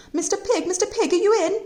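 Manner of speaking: high sing-song call at the door; wolf politely calling on the pig again. Acted.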